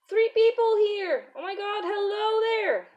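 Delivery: high, bright burst of joy; real delight at new viewers; excited greeting. Real, not acted. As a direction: squeal hello to the new viewers.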